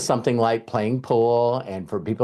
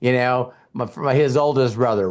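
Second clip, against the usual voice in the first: ordinary talk, nothing marked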